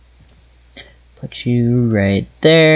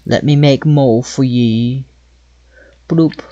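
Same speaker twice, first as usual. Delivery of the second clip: half-hearted British accent while playing. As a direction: chat along in a mock British accent. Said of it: "Gonna do the rest of this with a British accent".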